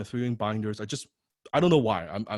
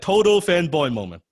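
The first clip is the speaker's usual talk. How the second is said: higher and louder, punching Total; excited emphasis; 7 semitones higher, 7 dB louder than usual. Real, not acted. Speaker relives meeting his hero, high excited emphasis. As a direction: hit "Total" hard with a high, loud "Total fanboy moment". Stresses fanboy moment.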